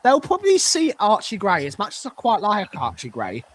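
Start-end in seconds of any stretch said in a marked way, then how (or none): none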